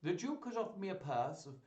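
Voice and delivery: a lightly voiced grand courtier; Le Beau declaring. Courtier Le Beau announcing to the ladies, mildly grand. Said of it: "he declared".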